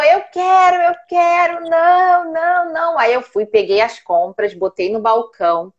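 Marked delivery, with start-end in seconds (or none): 0.0-3.0 high-pitched toddler tantrum, imitated; mock tantrum wail, held high; whining child. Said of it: "aí ele começou".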